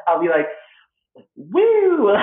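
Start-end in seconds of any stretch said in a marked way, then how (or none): 1.4-2.1 high, loud "woo"; performed anxious whoop. Acted. Comic performing own anxiety for a cheering audience, high whoop. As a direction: whoop loudly in mock anxiety. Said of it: "I'll start performing my own anxiety".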